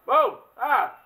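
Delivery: sudden loud frightened yelp; startled scream. Real, not acted. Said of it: "Dude, you scared me".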